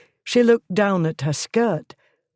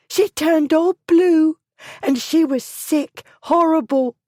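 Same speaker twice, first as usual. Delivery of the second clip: high, louder frightened shriek; 12 semitones higher, 5 dB louder than usual. A narrator's reading. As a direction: shriek it out in horror. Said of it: "Hannah shrieked".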